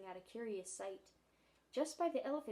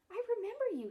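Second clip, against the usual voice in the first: put-on much higher, louder child voice; a small boy, eager and bright; little boy Michael; 11 semitones higher, 6 dB louder, much faster than usual.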